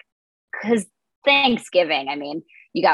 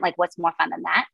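normal talking voice

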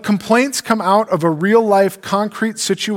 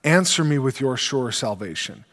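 scripture reading; 5 semitones lower than usual. Acted. Reads psalm aloud.

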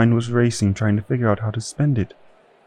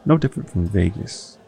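usual speaking voice

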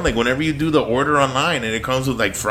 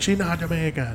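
imitated Jamaican accent; 4 semitones higher, 9 dB quieter than usual. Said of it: "waiting for Kevin to be like".

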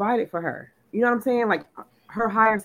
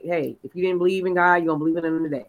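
usual speaking voice

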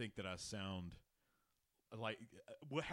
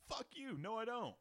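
much higher, squeaky comeback; mock retort; 12 semitones higher than usual. Host rejecting compliments on his voice, high mock retort. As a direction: fire back a pitched-up fake protest. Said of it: "I'm like".

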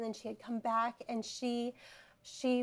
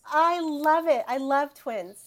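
higher and louder, gushing; delighted; 5 semitones higher, 9 dB louder than usual. Real, not acted. Host reacting to twin news, gushing and louder. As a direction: gush with joy over twins.

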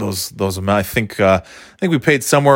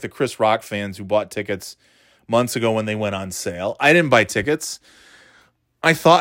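usual speaking voice

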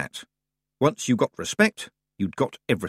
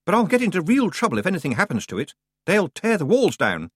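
higher, fast then slowing, worried; a nervous lad fretting; doubtful boy; 8 semitones higher than usual. A narrator's reading. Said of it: "said Roland doubtfully".

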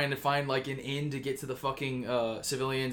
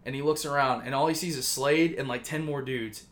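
plain reading voice

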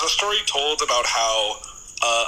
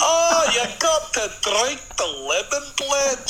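slow, high, put-on Welsh accent; 12 semitones higher than usual. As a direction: drawl it out in a hammed-up Welsh accent. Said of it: "Do it in Welsh".

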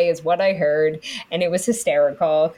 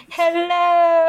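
mock hello; 11 semitones higher, 4 dB louder than usual. Mock greeting callback.

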